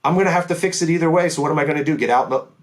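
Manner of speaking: hammed-up high voice listing what not to do; reasoning driver. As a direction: rattle off the pointless options half-mocking. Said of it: "He's like".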